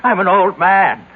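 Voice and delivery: loud, high despairing protest; anguished outburst. Acted. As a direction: throw the insult of age back at him, loud and raw. Aged man protests in despair.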